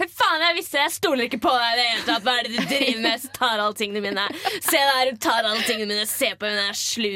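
loud, hammed-up suspicious outburst; drunken accusation. Drunk student accusing the friend holding her bag, loud retold indignation.

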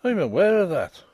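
pointed, grim reply; rather grim. A narrator's reading. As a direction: answer grimly in a brogue. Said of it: "rather grimly".

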